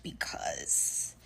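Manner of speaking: whispered, as if letting a secret slip; whispered aside. Retold or imitated. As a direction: hush it like a confidence. New lady passing on a secret.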